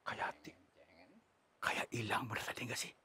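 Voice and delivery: hushed whisper in the bushes. Real, not acted. Ghost hunter hushing his crew, real whisper. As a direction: whisper so the thing won't hear.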